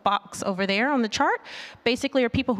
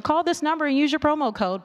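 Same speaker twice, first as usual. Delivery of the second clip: lightly hammed-up ad read, higher and brisk; 7 semitones higher, faster than usual. Imitating ad copy for the audience, light mock pitch. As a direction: sell the promo code like a host. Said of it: "you hear and say".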